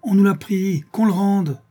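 high, loud demand; mob outcry. A narrator's reading. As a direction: cry out as an aggrieved crowd. La foule réclame le blé. (clameur revendicative)

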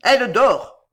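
loud, gruff insistence; grunted denial. A narrator's reading. Bohemian denying the death, loud gruff retort. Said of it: "grogna le bohémien".

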